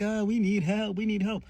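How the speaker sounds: high, loud whiny cry for help; hammed-up sobbing plea for help; mock crying plea. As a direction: whimper it like a beaten crook. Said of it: "they're crying and they're like".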